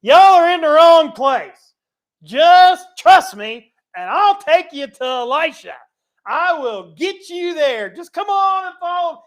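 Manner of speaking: high, loud, coaxing guide voice; playful Elisha paraphrase. Retold or imitated. Elisha leading blind soldiers, high and loud and coaxing. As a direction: coax the lost army along like a tour guide. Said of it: "he goes out and he's like".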